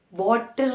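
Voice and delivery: water with a sounded final R; "water" with an audible R; R pronounced. Shown as a demonstration. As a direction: let the R be heard ending "water". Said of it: "नहीं बोला जाएगा".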